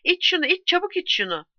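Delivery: high urgent urging; urgent shout. A narrator's reading. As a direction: push him to drink fast. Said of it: "diye bağırdı Giuliano". (aceleci, yüksek sesli ısrar)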